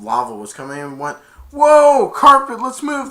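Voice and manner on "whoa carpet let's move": much higher, louder urgent cry; loud high panicked hurry-up; alarmed whoa. Retold or imitated. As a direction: flee the lava wave. Lava wave rushing at them.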